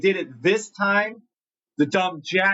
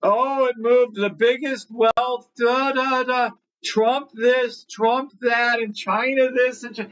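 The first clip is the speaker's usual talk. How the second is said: higher mocking singsong about the news; mocking news chatter; 8 semitones higher than usual. Trader mocking tariff news for his viewers, higher mocking singsong. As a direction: parrot the fearmongers with scorn.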